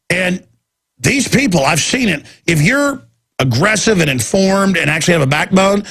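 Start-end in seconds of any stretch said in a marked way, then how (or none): none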